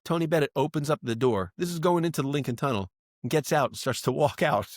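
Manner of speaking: usual speaking voice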